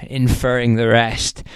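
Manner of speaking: ordinary delivery, nothing marked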